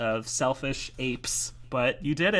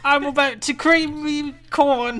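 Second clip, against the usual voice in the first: mock British accent; 14 semitones higher, 12 dB louder than usual. Repeating the joke to co-host, loud exaggerated British accent. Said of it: "sorry for any British people".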